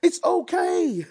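hammed-up comforter shushing tears, very high pitch; mock soothing. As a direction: hush a crying person like a baby. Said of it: "it's always like".

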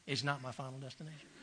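repeating the line through a chuckle; quiet Southern insistence with a laugh; laughing quietly. Retold or imitated. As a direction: say it fast, laughing softly.

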